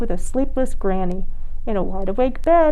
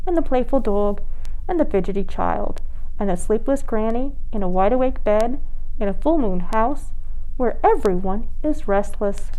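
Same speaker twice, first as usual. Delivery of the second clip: usual speaking voice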